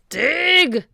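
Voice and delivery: captain shouting at his pirates; shouted order. A narrator's reading. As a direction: bark it at the crew. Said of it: "shouted Captain Bones".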